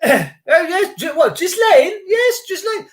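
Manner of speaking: high-pitched send-up of posh people; posh parent. Acted. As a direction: approve the baby name like a hammed-up toff. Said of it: "just posh people do".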